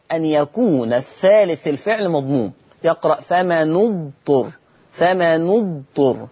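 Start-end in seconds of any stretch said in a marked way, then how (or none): 3.2-4.5 slow فمن اضطر as "fama-nu-dturr"; damma on the nun. Shown as a demonstration. Teacher shows Warsh's reading, slow "fama-nu-dturr" for فمن اضطر. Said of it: "الضم بشرط". 4.9-6.3 slow فمن اضطر as "fama-nu-dturr"; damma on the nun. Shown as a demonstration. Teacher shows Warsh's reading, slow "fama-nu-dturr" for فمن اضطر. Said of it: "هكذا".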